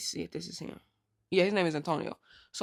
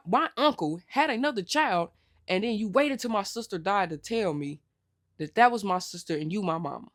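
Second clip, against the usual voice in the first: normal talking voice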